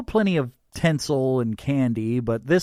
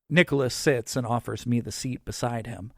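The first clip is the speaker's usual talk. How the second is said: ordinary delivery, nothing marked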